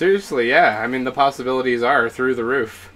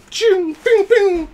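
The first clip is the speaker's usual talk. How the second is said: very high, loud pew noises; squealed laser zaps; imitated laser blasts; 7 dB louder than usual. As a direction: fire off sci-fi blaster sounds. Imitates Jedi training blaster sounds.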